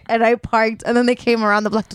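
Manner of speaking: normal talking voice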